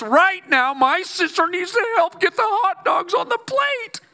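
high, rising exasperated rant; Martha blowing up. Retold or imitated. Martha ranting at Jesus, high and building to a shout. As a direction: let Martha boil over at Jesus. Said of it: "she's already kinda blowing".

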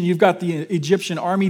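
ordinary talk, nothing marked

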